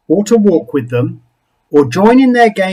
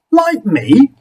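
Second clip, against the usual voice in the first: high incredulous question; disbelieving; 7 semitones higher than usual. Acted. Something Else doubts the creature, high and incredulous. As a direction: scoff at the idea.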